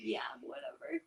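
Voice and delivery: whispered dismissive aside; whispering. Real, not acted. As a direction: breathe it off dismissively.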